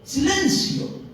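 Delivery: high, drawn-out call for silence; mandando callar. Acted. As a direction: hush a whole crowd with one stretched word. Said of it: "manda a callar a todos". (orden solemne de silencio)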